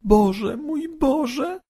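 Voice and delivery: put-on very high, tearful girl's cry; sobbing. A narrator's reading. Halszka sobs to God in terror, very high tearful cry. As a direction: break down crying to God. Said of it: "załkała Halszka".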